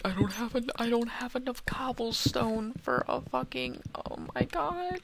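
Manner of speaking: high whiny plea; pathetic whine. Real, not acted. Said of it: "I sound so pathetic when I do that".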